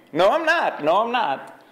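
high, shrill mock scream of protest; parodying her panicked shriek. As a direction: mimic her screeching denial. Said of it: "she just starts screaming".

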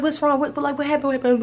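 high, stumbling anxious questions; retold frantic worry. Mother rushing over to her child, high frantic questioning. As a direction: imitate a flustered parent, pitched high.

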